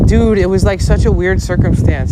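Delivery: the officer excited about a weird case; cop explaining. Retold or imitated. Officer calling about found stuff, animated. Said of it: "And he goes".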